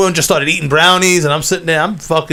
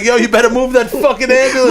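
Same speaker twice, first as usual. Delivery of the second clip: angry shout; 6 semitones higher than usual. Retold or imitated. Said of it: "someone's like".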